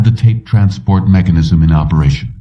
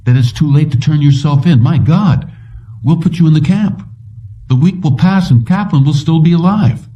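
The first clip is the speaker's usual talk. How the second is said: high, protesting argument; insistent inquiry; 7 semitones higher than usual. A narrator's reading. Whitwer urges Anderton to turn himself in, insistent inquiry. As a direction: argue it out with pitch riding high. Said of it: "Whitwer inquired".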